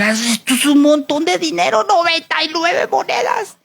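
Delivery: high-pitched hammed-up astonishment; amazed delight. Servant discovering a pile of gold coins, amazed. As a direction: gasp it out high, stretching the end.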